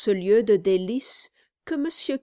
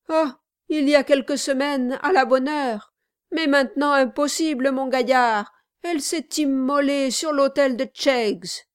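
higher, louder protest; emphatic lament; 5 semitones higher, 4 dB louder than usual. A narrator's reading. Guest telling his host she chose Cheggs, protesting. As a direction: declaim the loss theatrically.

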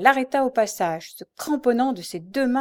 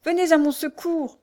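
high, distressed appeal; exclaimed plea for help; 8 semitones higher, 4 dB louder than usual. A narrator's reading. Blind girl held down calls for help, distressed. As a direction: beg desperately for rescue. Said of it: "s'exclama la pauvre Louise".

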